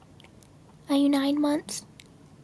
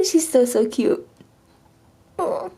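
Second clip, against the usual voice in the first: normal talking voice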